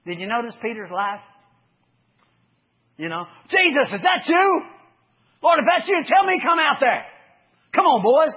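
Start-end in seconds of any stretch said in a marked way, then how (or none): 3.5-8.3 high, loud eager call across the water; Peter calling out. Acted. Peter shouting from the boat to Jesus, eager and loud. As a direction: call out to Jesus, keen to come.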